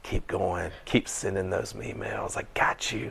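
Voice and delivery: soft, smug taunt; quiet gloating aside; mild hushed gloat. Retold or imitated. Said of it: "And I'm like".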